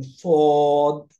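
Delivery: very slow, thick সদ with a dark, heavy vowel; heavy Arabic sad. Shown as a demonstration. Teacher demonstrating the heavy letter সদ to students, slowly and thickly. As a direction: draw out সদ slowly with a full, heavy mouth. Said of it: "আমি একটু দেখিয়ে দিচ্ছি একবার".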